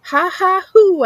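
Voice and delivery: high, loud ha ha hoo cackle; a wild creature jeering at leashed dogs; mocking animal laugh. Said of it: "I say".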